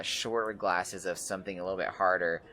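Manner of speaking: ordinary delivery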